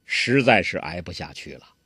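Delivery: ordinary delivery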